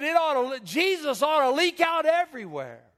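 put-on high, loud, emphatic little boy; little boy's punchline. Little boy concluding about Jesus, high and loud. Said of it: "and the little boy says".

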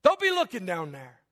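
high-pitched acted rebuke; mock scolding. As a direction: scold him like an exasperated friend. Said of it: "would have slapped him upside the head and said".

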